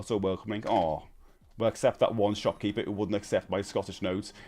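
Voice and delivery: usual speaking voice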